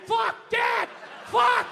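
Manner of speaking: high, loud panicked yelling; frantic shouted curse. Acted. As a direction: scream it like the house is on fire. Screaming in panic at dad.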